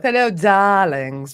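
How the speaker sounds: slow, drawn-out hammy English greeting; hammed-up English show opener; put-on English accent. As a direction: greet them in a mock English accent, stretching it out. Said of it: "to me, that's English".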